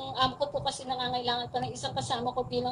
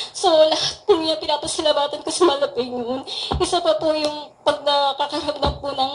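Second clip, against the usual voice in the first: louder and louder, preaching with passion about the Lord; fervent testimony; 10 dB louder than usual. Acted. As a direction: testify to the congregation at full force.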